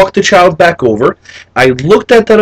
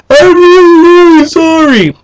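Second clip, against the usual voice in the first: loud, high-pitched mock bawling; fake crying apology; 13 semitones higher, 5 dB louder than usual. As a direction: overdo it like a little boy wailing sorry. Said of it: "fake emotion to get away with anything".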